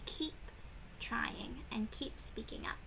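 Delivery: hushed unvoiced whisper on the last words. Teacher reading aloud whispers to the class. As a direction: drop to a secret whisper for the kids.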